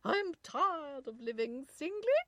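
loud, high, slow English recitation; English nurse accent. A narrator's reading. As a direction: declaim in grand English tones. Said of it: "the nurse went on".

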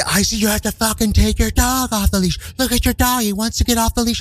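high mock rant; screaming stranger. Stranger ranting about a leashed dog, high imitation yelling. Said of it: "He just continues to scream".

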